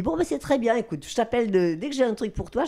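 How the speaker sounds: high, breezy quoted brush-off; lightly voiced dismissive director. Director brushes her off politely.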